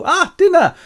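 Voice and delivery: very high, loud mock delight; gleeful cry. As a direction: squeal over a meal, hammed-up. Three sisters receive the bag.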